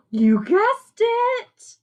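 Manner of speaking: high, slow, stretched-out delight; excited reveal. Acted. Teacher revealing the number to her young listeners, excited. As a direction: draw it out like a big surprise.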